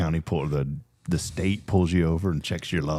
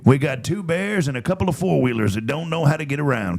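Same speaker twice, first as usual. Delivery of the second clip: CB trucker on the radio; over walkie talkie; 7 semitones higher than usual. Pretend. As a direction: call it in like a trucker. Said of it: "Was that okay or not really?".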